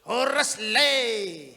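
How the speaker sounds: loud slow Batak horas lae; Batak greeting. Retold or imitated. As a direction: call out a Batak horas to a friend. Drunk Batak man greets Noah.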